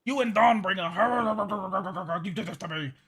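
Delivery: drawn-out name breaking into guttural barks; hammed-up hysterical growling; aggressive, rabid rant. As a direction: imitate a frothing man snarling accusations. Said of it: "he kept just being aggressive".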